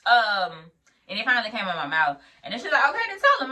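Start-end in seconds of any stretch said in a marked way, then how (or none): 2.9-3.5 high, loud retold command; imitating exasperated instructor; impatient prompting. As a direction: push them on, voice raised.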